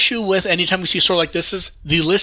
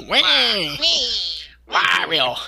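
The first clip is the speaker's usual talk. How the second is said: Wario noises; much slower than usual. Retold or imitated. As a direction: yell it like the cartoon villain Wario. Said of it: "just me and May going".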